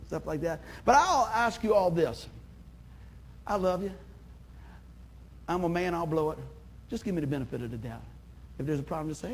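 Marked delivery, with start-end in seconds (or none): none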